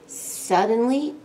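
ordinary delivery, nothing marked

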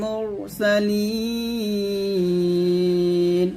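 slow chant, ইন্নাকা with long nasal hum; Quran recitation. Shown as a demonstration. Teacher reciting so students can follow. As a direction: draw the verse out melodically. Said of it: "এরকম".

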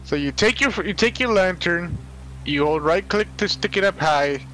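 usual speaking voice

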